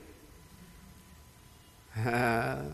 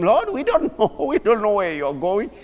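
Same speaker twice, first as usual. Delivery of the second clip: higher, louder, laughing disbelief; Thomas, laughing; 6 semitones higher, 5 dB louder than usual. Retold or imitated. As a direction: play Thomas half laughing. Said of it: "Thomas said to him".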